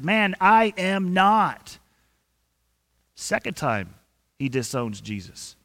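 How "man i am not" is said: slow, higher, louder flat denial; emphatic denial. Retold or imitated. Peter flatly denying he knows Jesus, slow and louder. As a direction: spell out each word, dug in. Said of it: "And his exact words, he says".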